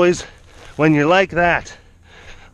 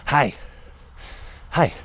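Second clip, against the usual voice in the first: ordinary talk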